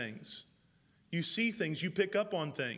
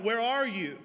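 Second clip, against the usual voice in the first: God calling a name across the garden, raised; a loud call into the garden; 5 semitones higher, 5 dB louder than usual. Acted.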